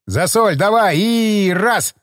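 hammed-up barked order. A narrator's reading. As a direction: bark the heave order. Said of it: "рявкнул Чёрный".

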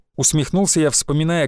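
usual speaking voice

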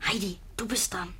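hushed prompt to a friend mid-poem; whisper. Acted. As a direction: whisper it so only she hears. Flüstert beim Vortrag. (flüsternd, leise Aufforderung)

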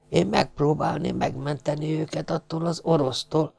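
a hurt, dying foreigner pleading his case; fading sailor, offended and sincere; wounded sailor. A narrator's reading. Wounded sailor explains himself.

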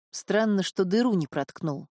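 plain reading voice

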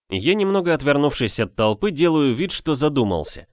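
ordinary delivery, nothing marked

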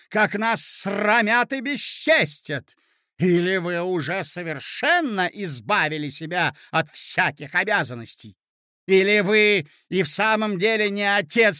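berating. A narrator's reading. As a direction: tear into the husband.

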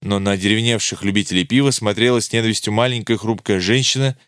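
ordinary delivery, nothing marked

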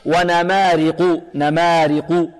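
slow chanted نمارق with a thin r; drawn-out نمارق, light r. Shown as a demonstration. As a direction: recite ونمارق keeping the r unemphatic. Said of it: "مرققة".

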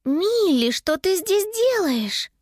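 high, slow, puzzled question; baffled, drawn-out questioning; bewildered. A narrator's reading. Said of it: "растерянно спросила Анника".

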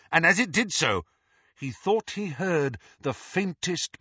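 plain reading voice

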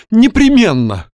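high, loud melodramatic declaration; overwrought. A narrator's reading. Young man blocks the doctor's way, overwrought. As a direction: proclaim your doom, loud and high. Overwrought youth insists he will die.

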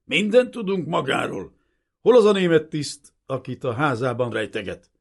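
loud, high hostile interrogation; yelled accusation. A narrator's reading. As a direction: bellow the demand at a suspect. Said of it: "üvöltött rá hirtelen".

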